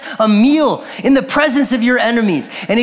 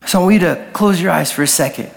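lower, unhurried invitation to prayer; reflective altar call; 4 semitones lower than usual. Real, not acted. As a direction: gently lead them into reflection, leaving long pauses. Quiet closing reflection, eyes closed.

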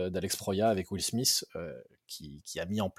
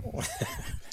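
wheezy breathy laugh; odd raspy laugh; 8 dB quieter than usual. Real, not acted. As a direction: laugh like Christophe Lambert. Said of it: "tu rigoles comme Christophe Lambert".

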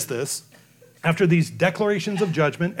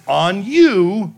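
proclaiming judgment; 4 semitones higher, 8 dB louder than usual. Acted. Jesus pronouncing woes on Pharisees.

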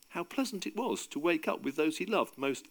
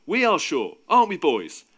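louder, higher, calling to the group; raised voice; 5 semitones higher, 8 dB louder than usual. A narrator's reading. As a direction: raise your voice to rally the others. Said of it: "raising his voice a little".

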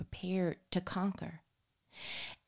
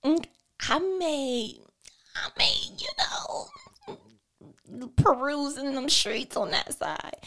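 high whispered, drawn-out warning; hint slowly at trouble, hammed-up; mock mom threat; 9 semitones higher, 5 dB louder, slower than usual. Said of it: "every mother uses that line".

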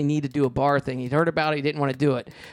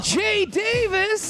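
a drawn-out, high, thrilled shout of the name; excited call; much slower than usual. Retold or imitated. [name] spots the producer and calls his name in excitement. [name] greets him, starstruck.

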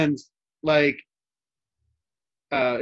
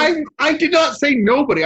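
higher, louder objection; mock-indignant protest; 13 semitones higher, 6 dB louder than usual. Real, not acted. Host protests co-host's teasing misquote, loudly indignant. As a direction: push back loudly at the misquote.

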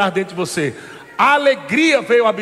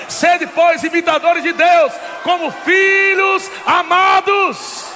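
scripture proclaimed; 5 semitones higher, slower than usual. Acted. Preacher quoting Paul to the church, proclaiming. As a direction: proclaim the verse, stretching its end.

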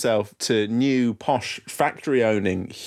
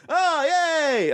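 mock excited cheer. Imitating audience's relief.

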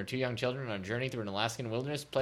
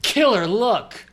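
loud, high, drawn-out title reveal; hammed-up title announcement; 8 semitones higher, 10 dB louder, much slower than usual. Reading book titles to co-host, hammed-up announcement.

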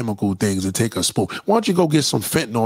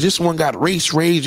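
normal talking voice

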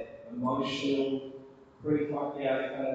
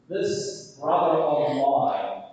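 slow, loud, pointed disdain; acted sibling resentment; resentful brother; 8 dB louder, much slower than usual. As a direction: lean heavily on the words. Resentful elder brother quoted, stressed.

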